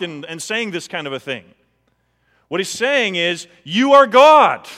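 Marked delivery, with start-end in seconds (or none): 3.6-4.7 loud, high, drawn-out declaration; emphatic proclamation. Retold or imitated. Preacher voicing Thomas's confession to Jesus, loud emphatic proclamation. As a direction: proclaim it slowly at full voice, pitched up.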